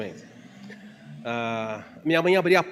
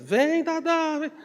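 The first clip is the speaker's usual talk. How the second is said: high, drawn-out call across the yard; mother's call; 12 semitones higher, much slower than usual. Retold or imitated. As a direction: holler it like mum at the door. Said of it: "todos os dias minha mãe fazia isso".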